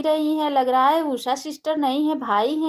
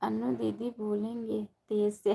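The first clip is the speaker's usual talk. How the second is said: normal talking voice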